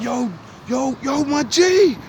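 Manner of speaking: high, loud call across a fight; shouting high to stop the fight; retold shout. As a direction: yell to get his attention.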